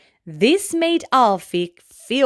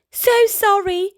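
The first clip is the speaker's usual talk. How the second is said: much higher, louder called-out apology; shouted apology; 10 semitones higher, 3 dB louder than usual. Acted. Alfie shouting an apology to the departing buffalo. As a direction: call sorry after him as he leaves. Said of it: "shouted Alfie".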